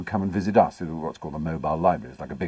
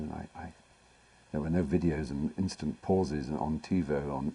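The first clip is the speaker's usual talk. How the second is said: usual speaking voice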